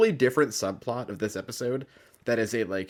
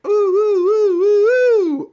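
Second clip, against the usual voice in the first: very high, loud drawn-out ooh; sustained falsetto ooh at full volume; seventies show transition whoop; 12 dB louder, slower than usual. Retold or imitated. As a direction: imitate a trippy TV scene-cut sound. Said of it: "that seventies show thing, like".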